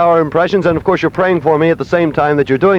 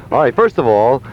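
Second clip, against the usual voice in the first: ordinary talk, nothing marked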